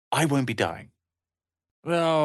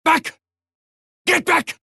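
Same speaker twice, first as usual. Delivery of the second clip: much higher and louder, yelling them off; frantic shout; 8 dB louder than usual. Acted. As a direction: shout them away in panic. Fending off attackers in battle.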